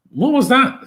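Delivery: sudden high-pitched disbelief; shocked outburst. Real, not acted. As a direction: react as if the pass makes no sense. Sudden bad pass during match.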